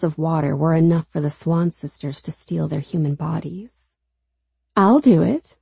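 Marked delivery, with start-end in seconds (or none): 4.8-5.6 put-on slurred, high sing-song drunk; tipsy girl singing it out. A narrator's reading. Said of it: "a sing-song, slurring voice announces".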